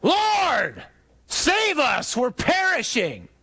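high-pitched yell across a roaring storm; panicked yell. Acted. Apostle to Jesus in the storm, panicked high yell. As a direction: cry for help to the back of the boat. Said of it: "one of us yells to the back of the boat".

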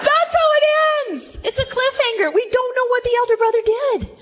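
high-pitched, excited exclamation; animated astonishment. Real, not acted. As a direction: exclaim over the abrupt ending, voice way up. Preacher exclaims at the abrupt ending.

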